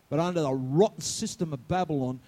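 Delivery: ordinary delivery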